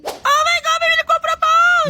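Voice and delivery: high-pitched, excited shriek; thrilled onlooker, very high voice; excited squeal. Acted. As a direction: squeal it out for the whole crowd to hear. Classmate squeals over a proposal.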